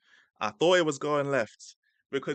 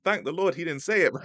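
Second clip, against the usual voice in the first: higher-pitched, heartfelt mock relief; retold huge relief; 6 semitones higher, 5 dB louder than usual. Streamer reliving the near slip-up for viewers, hugely relieved. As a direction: let out relief after a near miss. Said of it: "Yeah, I'm holding my heart".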